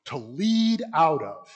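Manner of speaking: "To lead out of" slow, with weight on "lead"; spelled-out definition. Shown as a demonstration. Teacher defining exegesis for the class, slow and stressed. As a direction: stretch "To lead out of" and hit "lead". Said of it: "Greek form".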